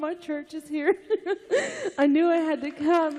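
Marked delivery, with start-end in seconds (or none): 1.5-3.2 a laugh that cracks into real crying; laughing into tears. Real, not acted. Mother testifying at church, laughing then crying. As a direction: let the tears break through the words.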